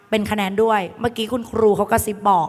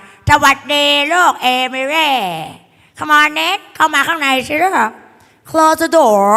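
high, slow, stretched teacherly welcome; raised singsong schoolteacher voice; acted teacher greeting; 5 semitones higher, much slower than usual. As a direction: play a teacher ushering in a pupil. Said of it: "ครูเขาบอกว่า".

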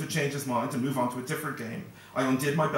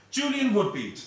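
confident; 8 semitones higher, 4 dB louder, slower than usual. A narrator's reading. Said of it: "in a confident tone".

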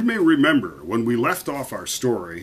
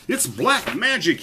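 high-pitched storybook alarm; mild shriek; 10 semitones higher than usual. A narrator's reading. Yeoman aghast at the new hat, high shriek. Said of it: "he shrieked".

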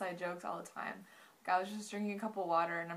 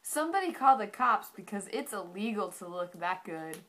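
cheesy delivery; 7 dB louder than usual. Pretend. Cheesy pickup line, loud.

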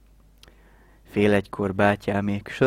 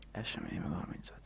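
fast hushed whisper; 4 semitones lower, 15 dB quieter, much faster than usual. Retold or imitated. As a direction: whisper it quickly under your breath. Friend's remark repeated in whisper.

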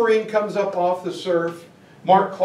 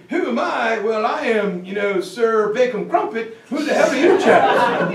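mock posh British; 3 semitones higher than usual. [name] asking the captain who he is, laughing mock British accent. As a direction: puff up like a stuffy English officer. [name] plays Sir Bacon Crumpet.

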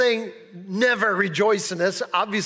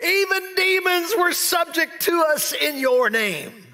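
joyful disciples; 8 semitones higher, slower than usual. Retold or imitated. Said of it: "they're joyful".

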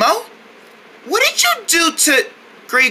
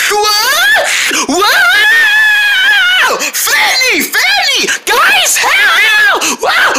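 pretend panic; 12 semitones higher, 5 dB louder, much slower than usual. Thrown around by the seal.

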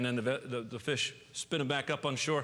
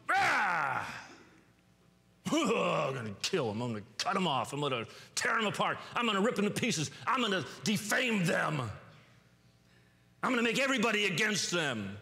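mock angry rant; 5 semitones higher than usual. Preacher mocks an angry person's rant.

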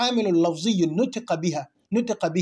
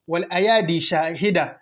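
rapid recitation; 2 semitones lower, much faster than usual. Shown as a demonstration. Quoting the verse again.

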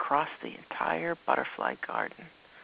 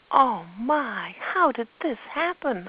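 put-on amazed child; 8 semitones higher, 7 dB louder, slower than usual. Olivia amazed by butterfly.

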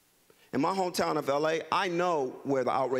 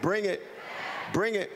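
call and response; 5 semitones higher than usual. Shown as a demonstration. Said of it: "you say back".

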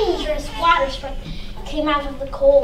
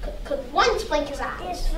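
plain reading voice

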